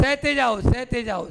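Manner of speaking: ordinary delivery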